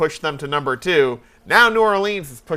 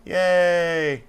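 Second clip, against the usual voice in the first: imitating a crowd's half-hearted mumbled cheer; mumbled yay. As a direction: cheer with barely any will left. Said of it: "you can hear just an audible, like, mumble".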